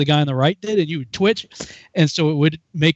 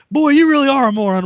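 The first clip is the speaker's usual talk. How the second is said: high, loud mock exasperation; sergeant insult; 9 semitones higher, 5 dB louder than usual. Sergeant holds recruit by neck, mock berating. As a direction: berate him like a drill sergeant.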